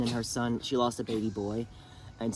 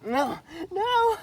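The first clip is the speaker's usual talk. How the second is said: much higher, hammed-up wailing no; mock crying wail; 4 dB louder than usual. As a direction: wail it like sobbing on the floor, overdone. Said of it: "she's on the floor crying".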